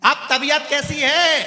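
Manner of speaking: high, loud, slow question; deaf man shouting. Retold or imitated. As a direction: bellow the question at the patient.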